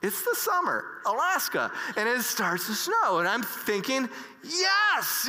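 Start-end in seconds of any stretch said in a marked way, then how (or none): 4.4-5.3 excited yes. Retold or imitated. Speaker thrilled that snow starts on the boat, high excited cheer. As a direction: cheer it out like a dream came true.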